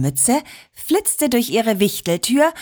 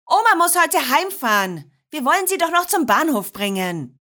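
higher-pitched mum calling through the house; 7 semitones higher than usual. A narrator's reading. Mum hurries the girls along from the kitchen, calling out. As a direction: call it across rooms without really shouting. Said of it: "rief Mama Nadine aus der Küche".

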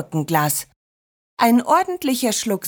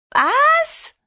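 put-on shocked child's cry. A narrator's reading. Children hear the train leaves soon, shrieking shocked. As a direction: squeal it far up high. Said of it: "schrien die Kinder gleichzeitig".

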